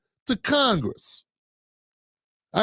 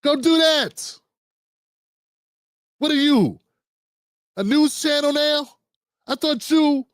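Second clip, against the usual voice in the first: higher, sarcastic jabs at her; mocking taunt; 5 semitones higher than usual. Real, not acted. Taunting Jasmine Crockett for always being on TV, sarcastic. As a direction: needle her like a heckler.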